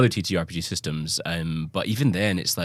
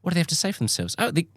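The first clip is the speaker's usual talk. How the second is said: high imitated Australian accent, building to mock protest; Australian psychic; 10 semitones higher, 3 dB louder, faster than usual. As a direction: channel spirits as an Australian, growing higher and flustered. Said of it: "like, an Australian psychic".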